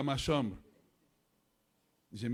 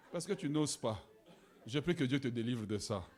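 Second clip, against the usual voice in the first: normal talking voice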